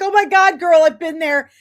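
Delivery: high, loud, emphatic pledge; retold urgent reassurance. Acting out eager offer to help.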